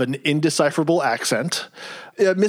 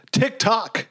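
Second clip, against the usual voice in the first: mildly stern retold warning; 7 semitones higher than usual. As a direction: imitate a stern teacher's warning. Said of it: "he says very sternly to him".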